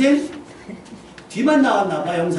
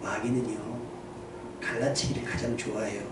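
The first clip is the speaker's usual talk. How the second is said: hushed, much softer secret aside; whispered in the ear; 9 semitones lower, 12 dB quieter than usual. Retold or imitated. Pastor whispering into the elder's ear, hushed. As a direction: lean in and murmur so others can't hear. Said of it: "귀에다 대고".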